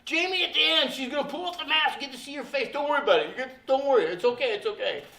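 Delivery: high, loud mock placating; gushing don't-panic patter, easing off by the end; frantic reassurance. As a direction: rush to calm someone down, imitating a bubbly assistant. Acting out assistant frantically placating him.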